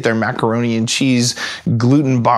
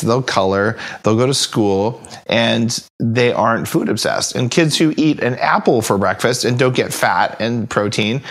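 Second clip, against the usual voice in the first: usual speaking voice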